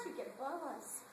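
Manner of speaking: ordinary talk, nothing marked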